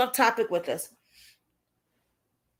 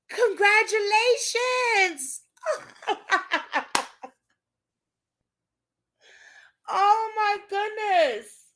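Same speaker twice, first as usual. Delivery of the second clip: tearful joy; 9 semitones higher, much slower than usual. Real, not acted. Tearful joy at viewer's news.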